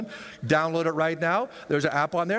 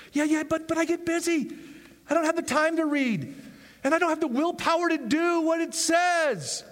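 whiny excuse-maker; 10 semitones higher than usual. Acted. Voicing excuses of a lazy believer.

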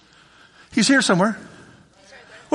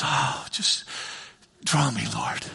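whispered "just draw me, Lord"; gentle prayer; 8 semitones lower, 9 dB quieter, slower than usual. Acted. Believer praying gently to the Lord, whispered. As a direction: breathe it like a soft plea.

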